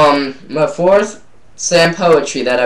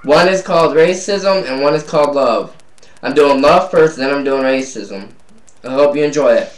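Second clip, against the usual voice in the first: ordinary talk, nothing marked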